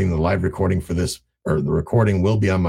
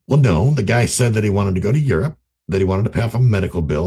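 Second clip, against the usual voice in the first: usual speaking voice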